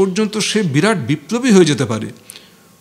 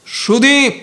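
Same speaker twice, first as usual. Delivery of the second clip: shouted call; 8 semitones higher, much slower than usual. Acted. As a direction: shout the name to catch him. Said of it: "চেঁচিয়ে একজনকে ডাকল".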